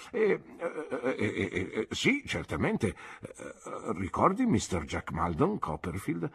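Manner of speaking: put-on doddering old doctor, halting and fading into hesitations; rambling old man losing his thread; kindly old doctor. Old doctor talking about his wife Annie, hesitant and fading.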